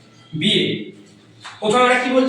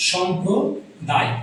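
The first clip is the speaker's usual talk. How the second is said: slow repeat; 3 dB quieter, much slower than usual. Shown as a demonstration. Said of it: "যাকে আমরা বলি সম্প্রদায়".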